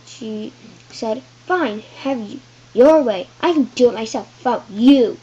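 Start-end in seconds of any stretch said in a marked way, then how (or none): 1.5-5.2 loud angry yell; a child's hammed-up angry yell. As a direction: shout it at her and storm off. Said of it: "Harold yelled".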